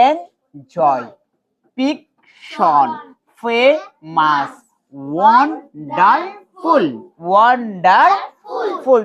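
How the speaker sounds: slow word list, every syllable separate; drawn-out syllables with small gaps between. Shown as a demonstration. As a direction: break "এনজয়" as এন-জয় and "ওয়ান্ডারফুল" as ওয়ান-ডার-ফুল. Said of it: "syllable করে করে পড়ো".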